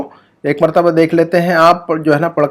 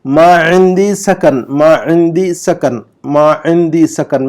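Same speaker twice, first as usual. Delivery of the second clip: slow, syllable by syllable Arabic; slow model reading; slower than usual. Shown as a demonstration. Teacher reads the Arabic example slowly for students. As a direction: read it out slowly for students to copy. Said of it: "number پانچ کا جملہ پڑھیے".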